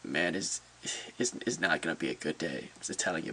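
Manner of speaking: low and soft, disheartened; quiet resignation after bad news; downcast. Real, not acted. Fan admitting the day is spoiled by loss, quietly downcast. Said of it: "is quite devastating".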